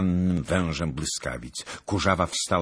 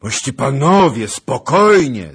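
loud, raised, drawn-out appeal; urgent call; 10 semitones higher, 17 dB louder than usual. A narrator's reading. Zagłoba calling the gentlemen to calm, loud and high. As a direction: call out over the uproar for order. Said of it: "zawołał Zagloba".

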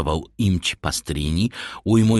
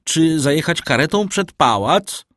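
high, louder called question; emphatic Italian-style call; 9 semitones higher, 5 dB louder than usual. A narrator's reading. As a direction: call it up grandly, pitch raised. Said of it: "włoskiej emfazy". (wołając z emfazą)

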